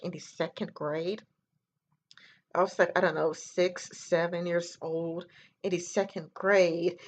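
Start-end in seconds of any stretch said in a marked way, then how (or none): none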